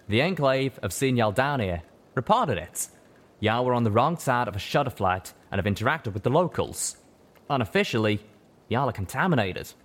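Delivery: plain reading voice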